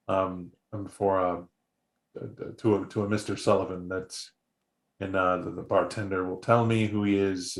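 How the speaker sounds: timid stranger stammering his errand under his breath; hushed nervous messenger. Acted. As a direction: mumble it, afraid to intrude. Nervous messenger speaks up softly.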